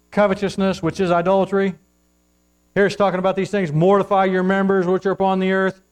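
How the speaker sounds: loud, high, driving scripture reading; full-force preaching. Acted. Preacher thundering the verse at the congregation. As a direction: read the vices like charges from the pulpit.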